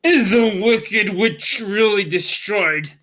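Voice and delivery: the mighty voice wavering with hidden fear; trembling Oz. A narrator's reading. The wizard hearing the witch is dead, mildly trembling. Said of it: "it trembled a little".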